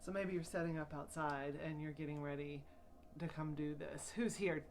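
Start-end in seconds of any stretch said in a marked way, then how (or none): none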